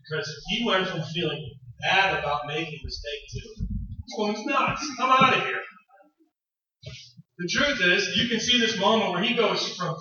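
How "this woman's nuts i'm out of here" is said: high, slow, fed-up voice; husband fed up with his wife, high and exasperated. Retold or imitated. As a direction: throw up your hands.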